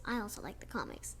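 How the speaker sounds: little murmured aside to viewers; quiet quick aside. Real, not acted. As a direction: slip it in softly between lines. Aside while reading.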